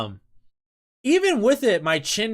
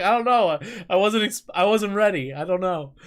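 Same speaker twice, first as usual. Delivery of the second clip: high, defensive mock protest; flustered excuse; 6 semitones higher, 5 dB louder than usual. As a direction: re-enact making a flustered excuse. Acts out own flustered excuse.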